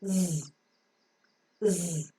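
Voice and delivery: "Z" as a soft buzzing "zzz"; low, soft voiced "zzz" for each "Z"; phonic Z sound. Shown as a demonstration. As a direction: buzz the sound of "Z" with no vowel. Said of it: "the phonic sound of Z is Z".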